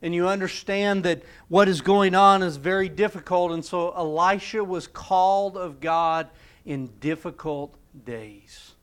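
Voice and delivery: normal talking voice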